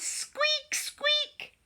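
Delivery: very high squeaky mouse; mouse squeak. A narrator's reading. Mouse voiced in a story.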